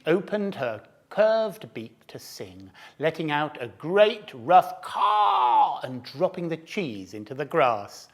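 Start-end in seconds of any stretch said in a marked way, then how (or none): none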